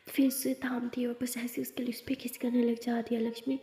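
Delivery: ordinary delivery, nothing marked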